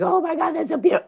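high, moved gasp at the sermon; retold tearful awe. Watching the royal wedding in a tea house, high moved exclaim. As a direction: recall being choked up by beauty. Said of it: "I was sobbing".

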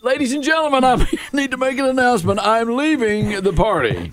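high, grand mock announcement; announcer voice. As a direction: announce your exit to the whole room. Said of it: "So you're like".

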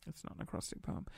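whispered self-correction; whispered aside. Real, not acted. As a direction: breathe the correction to yourself.

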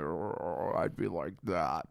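low, slow orc voice, kept quiet; orc accent. Shown as a demonstration. Podcaster auditioning for an orc part, low orc voice. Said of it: "give me your orc, um, accent".

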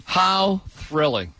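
slow, drawn-out mock delight; sarcastic. Real, not acted. Host reacting to the streaming announcement, sarcastic. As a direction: stretch each word with dry sarcasm. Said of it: "I'm being a little sarcastic".

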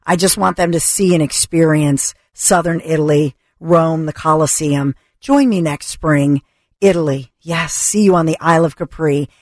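upbeat sales pitch read; radio ad read. Acted. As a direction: sell the tour like a live radio spot.

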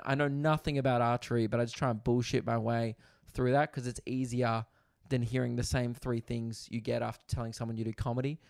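ordinary talk, nothing marked

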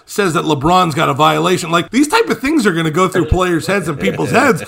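ordinary talk, nothing marked

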